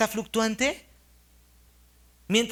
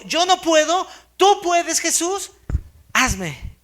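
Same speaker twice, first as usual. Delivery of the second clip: high, loud desperate plea; pleading cry; 6 semitones higher, 6 dB louder, slower than usual. Retold or imitated. Blind beggar begging Jesus to heal him, high loud plea. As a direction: beg for help with everything you have.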